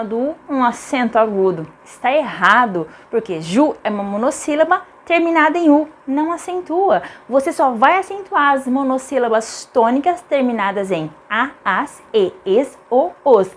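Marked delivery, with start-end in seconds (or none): none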